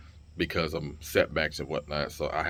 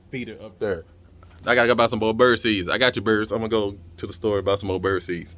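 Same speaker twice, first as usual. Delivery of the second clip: ordinary talk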